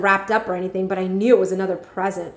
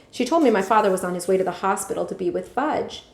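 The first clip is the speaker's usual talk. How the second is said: plain reading voice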